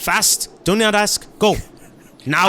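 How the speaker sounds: urgent command. Acted. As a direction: push them to act immediately. Character urgently orders the others.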